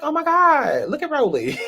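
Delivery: high, loud mock squeal of delight; gushing reaction. Retelling a reaction to a cast photo, excited gushing.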